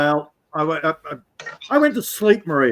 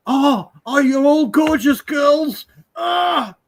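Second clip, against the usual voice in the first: much higher, whining mock swoon, slow; mocking swooning simps; 10 semitones higher, slower than usual. Mocking simps in the chat with a hammed-up swoon. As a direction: ham up a lovesick fan crying out. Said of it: "All the guys in the chat going".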